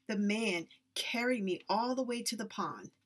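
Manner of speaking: ordinary delivery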